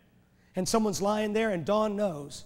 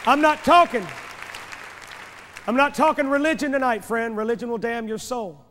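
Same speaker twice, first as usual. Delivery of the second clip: sudden loud, high preacher's cry; full-force preaching; 4 semitones higher, 6 dB louder than usual. Real, not acted. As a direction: thunder it at the congregation.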